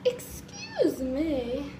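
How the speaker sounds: slow croaky lost voice; hoarse from yelling. Real, not acted. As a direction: drag it out with a shot voice. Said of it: "Do I literally have no voice left".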